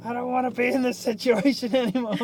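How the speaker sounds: high, laughing complaint. Real, not acted. Recalling the awkward pocket moment, laughing. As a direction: squeal it through a laugh.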